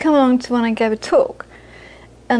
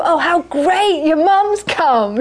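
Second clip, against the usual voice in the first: high, slow, gushing welcome; mock delighted greeting; 5 semitones higher, slower than usual. People at the talk greeting the speaker's mother, mock gushing. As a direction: stretch it out like a cheery hostess.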